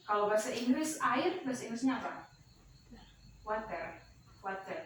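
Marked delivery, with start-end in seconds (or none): 3.5-4.8 American "Water" with a flapped, soft t; American accent. Shown as a demonstration. Teacher demonstrating the American "Water" for students. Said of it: "Itu aksen Amerika".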